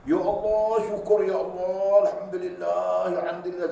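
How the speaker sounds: loud, high, drawn-out cry of alhamdulillah; tearful praise. Retold or imitated. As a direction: pour out thanks like a poor man overwhelmed with gratitude. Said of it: "menangis dia".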